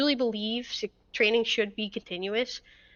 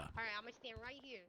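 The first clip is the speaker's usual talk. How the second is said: distant voice from across room; far off; 22 dB quieter, much faster than usual. Real, not acted. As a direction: speak from far away. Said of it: "make sure you're yelling at".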